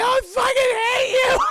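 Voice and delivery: very high, drawn-out mock shriek; hateful scream. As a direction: shriek it in a hammed-up tantrum. Belle screams at the Beast.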